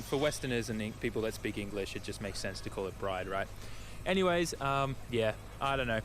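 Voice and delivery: normal talking voice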